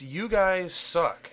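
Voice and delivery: dramatic reveal. Acted. Reading the insulting email title.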